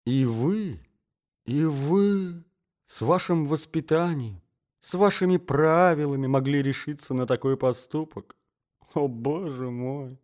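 high, pained disbelief; shocked reproach. Acted. Hostess reproaches the well-bred eavesdropper, shocked.

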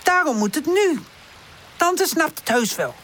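high, insistent plea; pleading. A narrator's reading. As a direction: beg and reason at once. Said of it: "zegt Woezel smekend".

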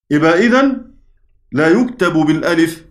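usual speaking voice

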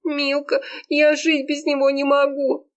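high, desperate wail; crying out. A narrator's reading. Serafima appeals to her friend, high despairing cry. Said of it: "выкрикнула Серафима".

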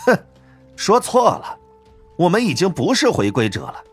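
high-pitched, scornful correction; cold sneer. A narrator's reading. Pete correcting his rivals about being returnees, cold sneer. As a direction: open with a scoffing huff, then sneer. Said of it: "皮特冷笑道".

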